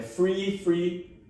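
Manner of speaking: "free" with F, standing in for "three". Shown as a demonstration. Showing three and free collapse together, F-substituted pronunciation. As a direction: say "free" twice, identically, with an F. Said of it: "agora você tem um problema".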